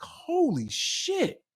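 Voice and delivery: high, drawn-out awe without shouting; retold amazement. Telling a friend about watching the Grammys, amazed. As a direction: stretch it out, stunned. Retold reaction to the award win.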